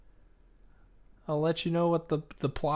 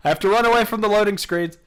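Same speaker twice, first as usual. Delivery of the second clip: excited; 5 semitones higher, 9 dB louder, faster than usual. Real, not acted. Laughing at scary game monster.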